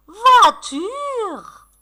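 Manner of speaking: "Voiture" high and drawn out in one sweep; delighted announcement. Pretend. Teacher welcoming pupils' word, "Voiture" high and excited.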